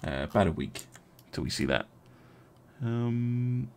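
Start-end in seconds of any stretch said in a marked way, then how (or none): none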